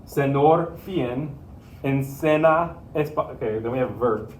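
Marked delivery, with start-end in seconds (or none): 0.1-3.3 "Senor" and "enseña" with plain n, no ny; missing tildes. Shown as a demonstration. Teacher reading the unwanted spelling aloud, plain-n mispronunciation. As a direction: slowly misread "enseña" as en-SEN-a, "Senor" as sen-OR. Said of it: "we don't want senor".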